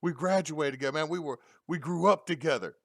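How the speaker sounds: high, loud pleading yell; imitating a yelling arrestee. Arrested dude yelling at the officer, high loud pleading. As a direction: beg the cop loudly, voice way up. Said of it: "he's yelling at the officer going".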